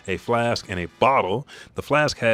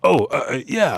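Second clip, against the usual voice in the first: high, loud stammered yes; flustered; 6 semitones higher, 6 dB louder than usual. A narrator's reading. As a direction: stammer out an unconvincing yeah. Acting Leuven's flustered answer.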